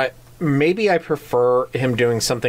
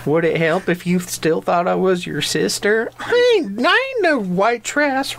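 Joe Dirt quote voice; 9 semitones higher than usual. Retold or imitated. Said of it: "Like the scene from Joe Dirt".

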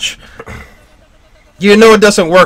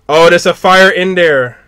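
normal talking voice

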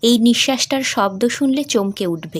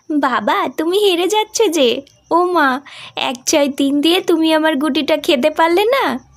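put-on excited girl; 6 semitones higher than usual. Daughter teasing father she is beating at ludo, excited. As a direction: crow over winning the game.

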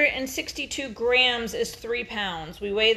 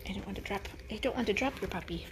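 ordinary delivery

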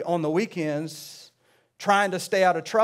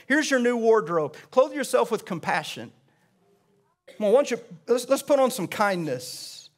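raised, rhythmic sermon delivery with punched words; preaching; 4 semitones higher, 3 dB louder than usual. Acted. Pastor urging the congregation to change, preaching with force. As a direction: preach it to the room, lifting every line.

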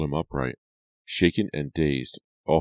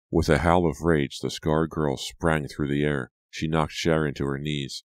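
plain reading voice

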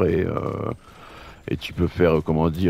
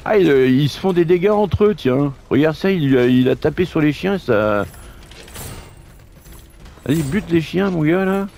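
higher teasing sidekick voice; a cheeky buddy, pitched up; Roger voice; 9 semitones higher than usual. Pretend. Roger voice cheering the fight.

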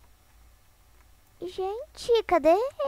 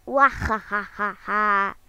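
slow hammed-up villain cackle; a mock evil laugh drawn out; 5 semitones lower, much slower than usual. Streamer playing the glitched emoji, slow evil cackle.

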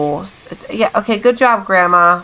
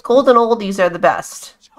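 usual speaking voice

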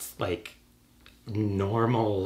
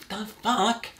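incredulous; 9 semitones higher than usual. Retold or imitated. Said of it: "it's like".

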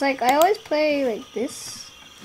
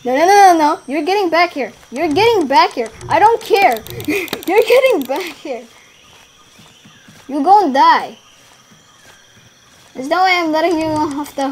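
higher, louder chasing threats; heated game taunting; 3 semitones higher, 6 dB louder than usual. Real, not acted. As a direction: yell after prey that's getting away. Yelling at escaping prey in game.

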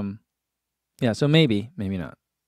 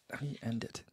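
much softer echo of the question; muttered to herself; 19 dB quieter, faster than usual. Real, not acted. Musing aloud, repeating the question far quieter. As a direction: repeat it under your breath.

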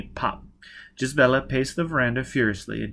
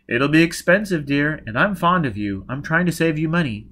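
lightly voiced doctor, louder and higher; slightly raised, reasoning character voice; Baker, cajoling doctor; 5 semitones higher, 6 dB louder than usual. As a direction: cajole a client with professed fondness.

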